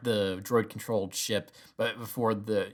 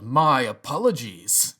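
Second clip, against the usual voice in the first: slow, louder clipped menace; cold Vader retort; 6 dB louder, slower than usual. Retold or imitated. Fan imitating Vader dismissing Krennic, slow and cold. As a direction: cut him off coldly. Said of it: "whirls around and goes".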